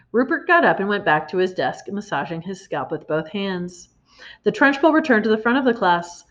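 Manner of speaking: ordinary delivery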